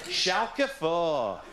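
put-on Yorkshire accent calling out clubs, high and slow; Yorkshire accent, slow, high, to freak out the tramp. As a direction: call each club in a slow Yorkshire accent. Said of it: "we all do Yorkshire accents naming German football clubs".

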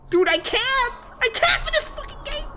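high frustrated yelling; panicking gamer. Real, not acted. Frustrated at the game.

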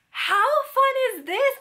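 high, gleeful question; excited. Real, not acted. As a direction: delight in the scratch-off. Gushing over scratch-off cards.

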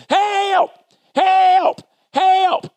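high, loud cries for help from down a hole; yelling for help. Retold or imitated. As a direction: call out like someone trapped and desperate. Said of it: "I kept hearing it".

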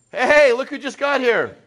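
high, loud greeting to a latecomer; cheery welcome. Real, not acted. Speaker greets a latecomer to the talk, cheery and loud. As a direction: call out a welcome to someone arriving.

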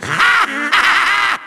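long, rapid villain cackle; evil cackle. Acted. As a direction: cackle gleefully in rapid bursts. Villain gloats at his foes.

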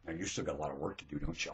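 low, soft, quick question; hushed, low-pitched prod; quiet needling. Real, not acted. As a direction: ask it low and fast. Quiet take before shouting it.

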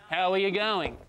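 full Aussie greeting, all syllables; Australian accent. Retold or imitated. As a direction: say every syllable, Melbourne style. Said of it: "the less syllables people use".

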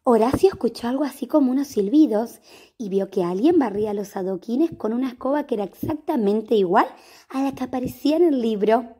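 ordinary delivery, nothing marked

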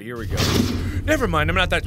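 ordinary delivery

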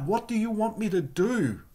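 high, desperate mock cry for instructions. Saul asking Jesus what to do, high pleading cry. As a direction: pitch jumps up, pleading for orders. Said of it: "he cries out".

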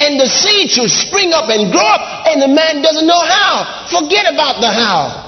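preaching shout. Real, not acted. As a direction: build it up and shout the point.

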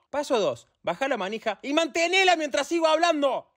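high, strained patience running out; exasperated instruction. Acted. Instructor repeating a step to pupil, exasperated. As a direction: repeat the step, fed up and rising in pitch.

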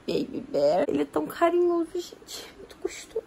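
softly whispered Portuguese endearments. Real, not acted. Cooing over sleeping baby.

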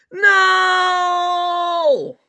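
long, high, loud wail of no; screamed refusal. Acted. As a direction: scream it out in drawn-out protest. Child refuses being told on.

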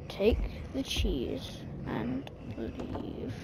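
soft, slow and drawn out; slow quiet coaxing. Real, not acted. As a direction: murmur it slowly, willing them to go. Coaxing chef, slow repeat.

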